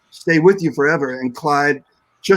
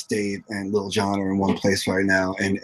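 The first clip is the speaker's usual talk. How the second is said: ordinary talk, nothing marked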